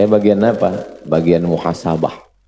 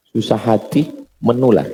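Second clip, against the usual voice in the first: usual speaking voice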